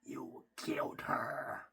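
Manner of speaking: slow, hushed, breathy sinister emperor; Palpatine quote. Retold or imitated. Fan quoting Palpatine to Anakin, slow sinister hush. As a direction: creep it out slowly like the Emperor. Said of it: "And then Palpatine".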